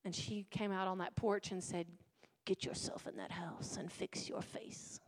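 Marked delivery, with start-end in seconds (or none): 2.4-5.0 hushed scolding whisper; mother whispering a stern order; whispered mom scolding. Retold or imitated. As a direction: hiss it under your breath like a mother. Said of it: "She didn't say it like that".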